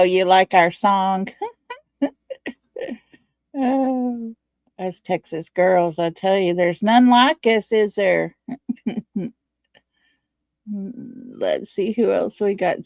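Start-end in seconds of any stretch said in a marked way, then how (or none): none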